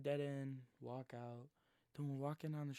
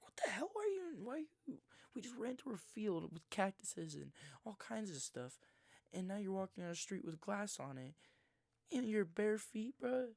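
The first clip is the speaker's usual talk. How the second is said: shocked disbelief; 6 semitones higher than usual. Retold or imitated. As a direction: react like you can't believe his feet are bare. Shocked at friend's missing shoes.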